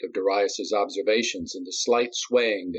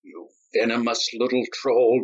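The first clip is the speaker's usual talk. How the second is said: venomous, spat out; spat insult; 3 semitones higher, 3 dB louder than usual. A narrator's reading. Nadi insulting Darius, spitting. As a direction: spit the words. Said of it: "Nadi spat".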